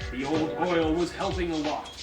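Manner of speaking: ordinary talk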